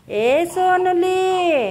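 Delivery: long stretched tender call; slow cooing. Real, not acted. Soothing crying baby.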